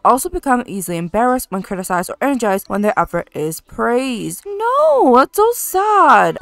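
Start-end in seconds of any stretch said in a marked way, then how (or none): 4.4-6.4 dismayed sympathy. Real, not acted. Sympathy for inferior-feeling child.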